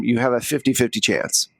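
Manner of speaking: normal talking voice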